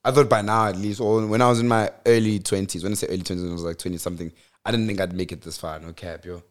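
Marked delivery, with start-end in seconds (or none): none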